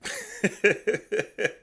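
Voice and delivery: a long real laugh; hearty real laugh. Real, not acted. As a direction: burst out laughing at the clip. Laughing at the promo.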